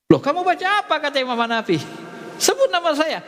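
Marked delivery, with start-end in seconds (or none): 0.1-1.0 much higher, alarmed "what did you say?"; sudden high surprise; startled question. Retold or imitated. The imam sees his follower sinking and asks, startled. 2.4-3.2 urgent order. Retold or imitated. Imam urgently tells follower.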